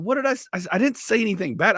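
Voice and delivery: high-pitched protest of innocence; mock defensive protest. Comic answering his manager's cussing complaint, high and defensive.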